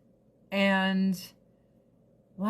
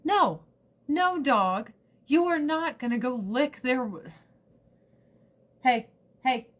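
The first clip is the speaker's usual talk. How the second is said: scolding the dog; 5 semitones higher, 4 dB louder than usual. Real, not acted.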